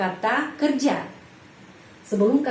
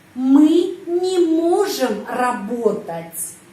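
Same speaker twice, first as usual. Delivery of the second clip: slow, louder, stress on не; slow model sentence; 4 dB louder, much slower than usual. Shown as a demonstration. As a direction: read each word out slowly for the class.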